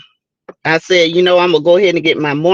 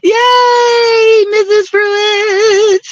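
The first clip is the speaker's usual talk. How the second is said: laughing, high cheer that slows on the name; thrilled to see her friend arrive; laughing whoop of welcome; excited cheer; 11 semitones higher, 3 dB louder, much slower than usual. Real, not acted. Greets a favourite viewer.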